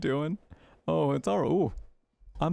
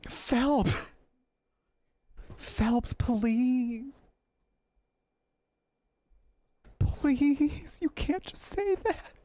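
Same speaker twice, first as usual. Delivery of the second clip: shocked pleading; 12 semitones higher, much slower than usual. Real, not acted. Pleading with detective on screen.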